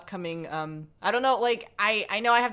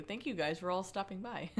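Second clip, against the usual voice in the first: ordinary delivery, nothing marked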